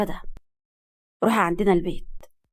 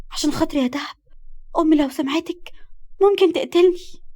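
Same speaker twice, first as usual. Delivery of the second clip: high, anxious entreaty; pleading; 6 semitones higher than usual. A narrator's reading. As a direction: beg a friend to keep your secret. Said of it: "وقالت لها برجاء". (رجاء وخوف)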